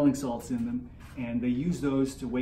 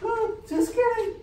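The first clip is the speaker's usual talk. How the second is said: very high-pitched comic character voice; put-on Mandy voice; 3 dB louder than usual. Friend orders food as Mandy, high put-on voice. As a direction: squeak out a silly order. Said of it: "You're doing your voice all wrong".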